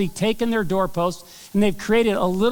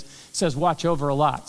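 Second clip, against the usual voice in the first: ordinary talk, nothing marked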